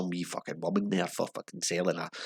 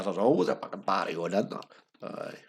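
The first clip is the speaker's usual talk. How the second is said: ordinary talk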